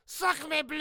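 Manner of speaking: high, loud French chef voice. Retold or imitated. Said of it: "it was something like".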